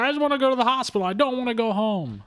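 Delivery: high, rushed whiny protest; mock tantrum. Retold tantrum of upset boy.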